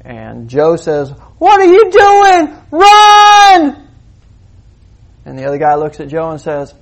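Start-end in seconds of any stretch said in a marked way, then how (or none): 1.4-3.9 high, loud frantic yell, drawn out; frantic shout. Acted. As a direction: scream at him to run. Joe yells at friend.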